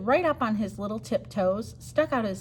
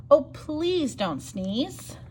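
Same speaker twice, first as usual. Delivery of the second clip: pleading plea; 5 semitones higher, much slower than usual. Acted. Animals pleading refrain.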